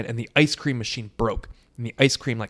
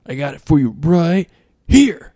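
loud, higher, drawn-out taunt; hammed-up swaggering boast; cocky trash talk; 5 semitones higher, 7 dB louder than usual. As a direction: build up to a big reveal. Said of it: "he starts talking shit to the troll".

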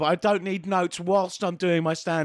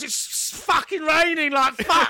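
put-on drunk barfly; 12 semitones higher, 4 dB louder, slower than usual.

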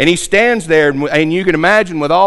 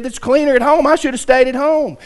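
retold huff, higher in pitch; enraged grumble; 9 semitones higher than usual. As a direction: storm off muttering about home, pitch raised. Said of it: "he goes off in a rage".

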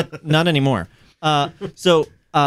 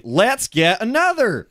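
eager; 11 semitones higher, slower than usual. Acted. Eager thought inside the read email.

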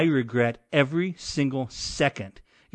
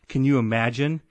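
ordinary talk, nothing marked

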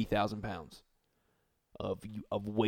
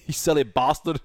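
mock British accent; 5 semitones higher, 7 dB louder than usual. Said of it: "Who invited the British guy".

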